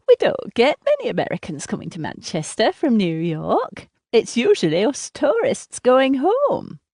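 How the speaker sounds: high, grating, almost nasal tourist. A narrator's reading. Woman chatting to her seatmate on a plane, grating and nasal. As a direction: pitch it up into a nasal, chatty traveler. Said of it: "She has a voice that grates, almost nasal".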